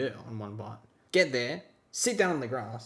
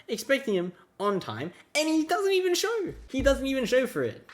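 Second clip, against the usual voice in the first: exasperated rant; 9 semitones higher than usual. Real, not acted. Ranting about late friend.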